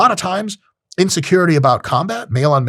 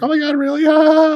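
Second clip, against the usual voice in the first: put-on high-pitched gossipy girl squeal; mocking girlfriends; 14 semitones higher than usual. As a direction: imitate girls squealing over gossip. Imagined girlfriends mocking the rejected guy.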